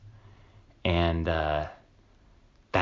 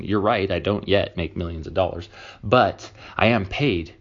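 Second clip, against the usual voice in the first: ordinary talk, nothing marked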